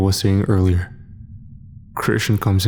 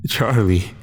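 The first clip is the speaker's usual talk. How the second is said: plain reading voice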